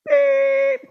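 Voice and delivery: high loud bleep tone; censor bleep. Retold or imitated. Pastor bleeping the visitor's cuss word, high bleep. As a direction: mimic a TV censor beep.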